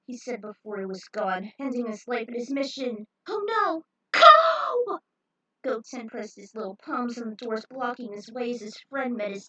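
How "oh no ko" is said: rising into a high, loud scream; alarmed cry. Acted. Goten crying out as his friend Ko falls. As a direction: cry out the friend's name in horror.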